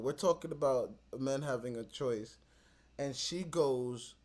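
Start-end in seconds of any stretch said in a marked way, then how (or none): 3.0-4.1 slow mocking. Real, not acted. Mocking her argument.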